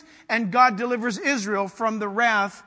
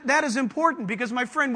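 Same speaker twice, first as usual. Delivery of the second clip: ordinary talk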